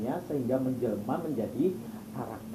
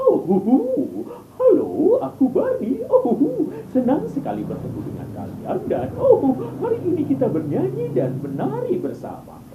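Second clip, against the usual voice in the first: high, bouncy belly voice; Barney voice; 9 dB louder than usual. Shown as a demonstration. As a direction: greet the kids like a jolly purple dinosaur. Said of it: "Itu pakai suara perut".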